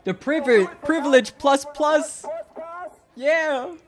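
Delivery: high, loud hype; thrilled reaction. Real, not acted. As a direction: crow it out in real delight. Delighted reaction to the protest clip.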